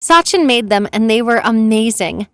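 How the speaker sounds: ordinary delivery, nothing marked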